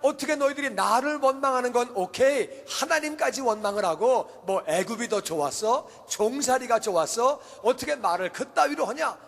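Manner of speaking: acted outburst of Moses, pitched high; scolding leader who has lost his temper; angry rebuke. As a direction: berate the crowd indignantly. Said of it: "모세가 열을 받은 거예요".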